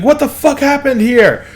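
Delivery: high-pitched panicked yelling; yelling back. Acted. Bystander in the crowd yells back at the guard, yelling. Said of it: "one of them yells back at you".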